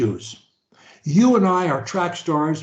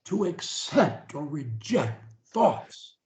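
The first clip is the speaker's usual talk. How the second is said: very slow, each word spaced for repeating; slow dictation; 5 dB quieter, slower than usual. Shown as a demonstration. Coach leading a client in repetition, very slow. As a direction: say it slowly for them to copy. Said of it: "say after me".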